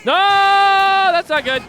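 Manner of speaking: high, slow, drawn-out yell; panicked shout. Real, not acted. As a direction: cry out in real alarm. Panic at game danger.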